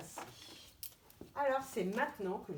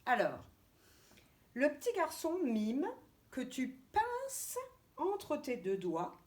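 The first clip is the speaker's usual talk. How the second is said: ordinary delivery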